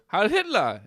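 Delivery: "Heil Hitler" shouted high and loud; loud mock soldier salute; mock barked salute. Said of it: "the guy goes".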